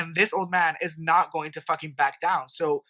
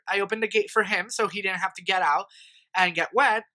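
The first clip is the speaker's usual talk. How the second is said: usual speaking voice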